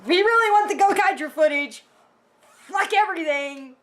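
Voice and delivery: parodying the writers who only wanted footage, brash; mock producer. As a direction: blurt it like a careless exec. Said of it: "they were just like".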